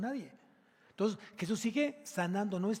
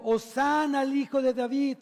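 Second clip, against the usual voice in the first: loud, raised crowd acclamation; crying out; 7 semitones higher, 9 dB louder than usual. Acted. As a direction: cry it out high and loud in the temple. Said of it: "clamando en el templo".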